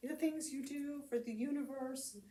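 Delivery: high, gushing parody of sweetness; mock sappy. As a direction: lay on the syrupy adoration as a joke. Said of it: "being all sappy and going".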